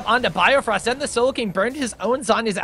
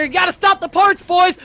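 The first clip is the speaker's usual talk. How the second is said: excited shout; 8 semitones higher, 5 dB louder than usual. Real, not acted. Caster urging players mid-fight.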